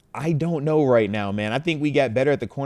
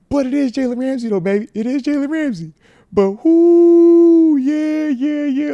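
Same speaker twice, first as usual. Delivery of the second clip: much higher, giddy and louder; excited teasing; 8 dB louder than usual. Real, not acted. Dad teases his heartbroken son about the new player, giddy and high. Teasing consoling after son's cry.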